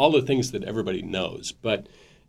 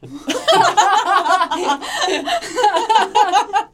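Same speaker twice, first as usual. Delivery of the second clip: loud high laugh; 7 dB louder than usual. Real, not acted. Laughing at own joke.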